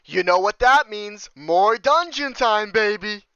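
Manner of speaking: loud, high mock cheer; bitter sarcasm. Real, not acted. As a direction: sell the fake excitement, dripping sarcasm.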